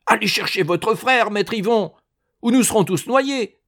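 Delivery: high, loud desperate shout. Acted. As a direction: call out in panic. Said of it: "les hommes crièrent".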